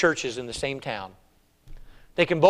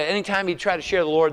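ordinary talk, nothing marked

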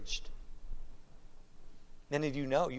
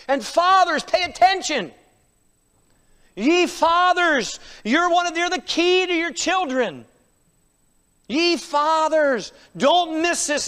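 high, loud pulpit exhortation; forceful preaching; 7 semitones higher, 7 dB louder than usual. Real, not acted. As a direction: drive each command home.

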